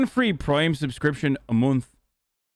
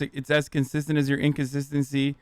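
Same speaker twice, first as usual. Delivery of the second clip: usual speaking voice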